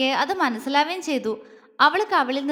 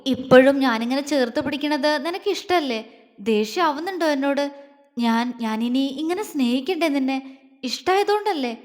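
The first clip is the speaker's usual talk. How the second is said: usual speaking voice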